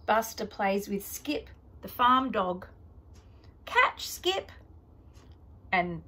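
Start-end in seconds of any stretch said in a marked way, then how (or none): 3.6-4.5 high call to a dog. Acted. Buster calls to dog.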